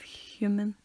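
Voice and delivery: "human" with an audible H at the start; pronounced H. Shown as a demonstration. Friend explaining the H sound, "human" with a careful airy H. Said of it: "the way you have to make the H sound".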